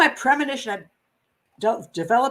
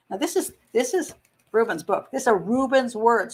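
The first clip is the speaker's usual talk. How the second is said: normal talking voice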